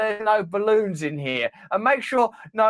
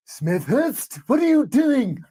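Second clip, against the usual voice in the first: angry boss; 8 semitones higher, much slower than usual. Acted. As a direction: bark at the underling. Boss voice in skit.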